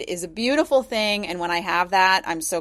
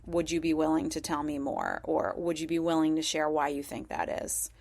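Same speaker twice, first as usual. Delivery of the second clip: soft ask; 3 semitones lower, 8 dB quieter than usual. Shown as a demonstration. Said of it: "the choice is really powerful".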